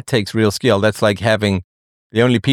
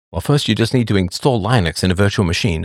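usual speaking voice

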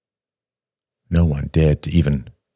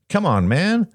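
suddenly loud; 14 semitones higher than usual. A narrator's reading. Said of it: "Robert said suddenly loud".

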